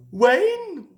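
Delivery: high, loud squeak; a sharp little excited squeak. A narrator's reading. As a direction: squeak it out together like small excited engines. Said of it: "they squeaked in unison".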